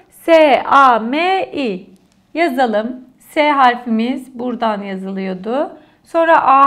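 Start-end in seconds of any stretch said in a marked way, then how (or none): 0.1-1.9 "S-A-M-I" spelled letter by letter, a bit louder; teacher spelling a word letter by letter for the class. Shown as a demonstration. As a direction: spell "S-A-M-I" out slowly, each letter separate. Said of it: "Sami'yi nasıl yazmamız gerekiyor?".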